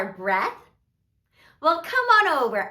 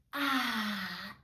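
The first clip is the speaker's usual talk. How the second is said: soft open-mouthed breath out; long exhale; 7 semitones lower, 11 dB quieter than usual. Shown as a demonstration. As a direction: let the air out in a long "ahh". Exhale demonstrated in the story.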